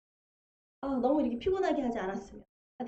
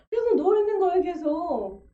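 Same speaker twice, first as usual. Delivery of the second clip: preaching climax; 5 semitones higher, 6 dB louder than usual. Real, not acted.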